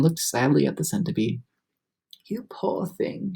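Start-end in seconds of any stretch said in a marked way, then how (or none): 2.3-3.3 soft, slow sympathy; murmured. A narrator's reading. Miss Spider comforting the centipede, soft murmur. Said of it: "she murmured".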